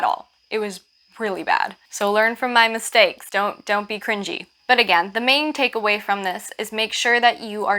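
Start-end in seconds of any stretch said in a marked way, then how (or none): none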